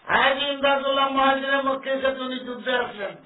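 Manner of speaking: high, loud sustained delivery; forceful preaching. Real, not acted. Preacher tells of Haji Imdadullah going to battle, forceful preaching. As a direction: announce it to the whole gathering.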